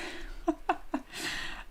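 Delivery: soft, high-pitched laugh. Real, not acted. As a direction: laugh quietly at your own slip. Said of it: "I'm just laughing".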